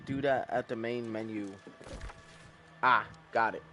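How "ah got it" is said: ordinary talk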